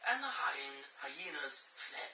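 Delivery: lightly voiced nature documentary narration; documentary style. Shown as a demonstration. Narrator doing documentary style for the kids. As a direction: read it like a hushed nature film. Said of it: "I can do it documentary style".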